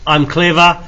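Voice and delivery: ordinary delivery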